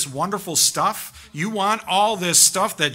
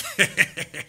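short chuckle. Real, not acted. Imagines cheering Jesus on.

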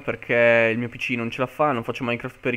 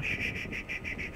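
whispered shushing; 14 dB quieter than usual. Real, not acted. Hushing himself, hiding from mobs.

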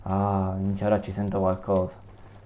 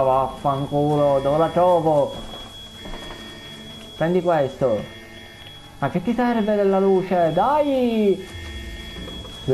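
explosive Italian cursing; 7 semitones higher, slower than usual. Real, not acted. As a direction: yell and curse in a panic. Frantic escape, cursing.

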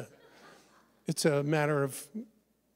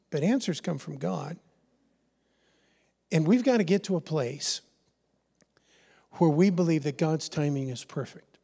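normal talking voice

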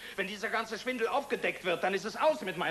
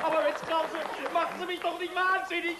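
Aber Mensch pitched much higher, pleading in despair; exasperated outburst; 10 semitones higher than usual. Acted. Pleading with Krause to stop the chaos, exasperated and high. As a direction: throw it up high, at wit's end.

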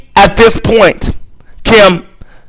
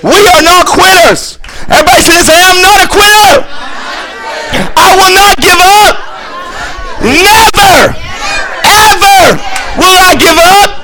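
high, shouted rallying cry; shouted preaching; 12 semitones higher than usual. Real, not acted. Preacher leading everybody in a vow not to quit, shouted preaching. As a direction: belt out each phrase for the crowd to repeat. Leads congregation in shouted declaration.

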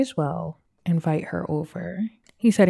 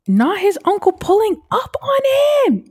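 gasp, then a much higher, louder line; shocked gasp; 7 dB louder than usual. Real, not acted. Player gasps at the uncle showing up, shocked. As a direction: caught off guard by the uncle arriving. Uncle caught him stealing.

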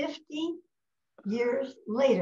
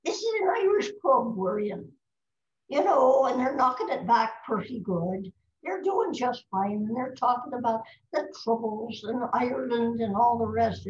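lilting Irish brogue, pitched up; Irish storyteller; 4 dB louder than usual. Acted. Guest tells an old Irish pub story, Irish brogue. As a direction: tell a pub joke in a lively Irish accent. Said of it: "let's see if I can do it".

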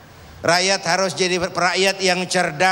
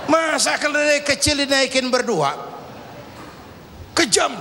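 mock outrage; 5 semitones higher than usual. Bystanders outraged at donkey riders.

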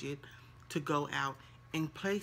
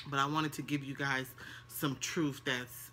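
normal talking voice

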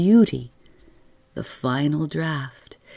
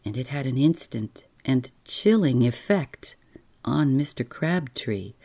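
ordinary delivery

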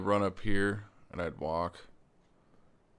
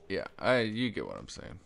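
ordinary delivery

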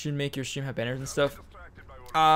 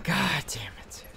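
whispered curse; slower than usual. Real, not acted. Muttered after losing fight.

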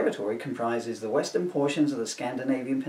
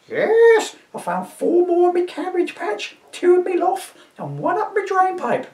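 put-on high shrieking old woman; an indignant old dear shooing a Viking; old lady to constable, high and indignant; crone voice; 6 dB louder than usual. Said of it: "my crone voice".

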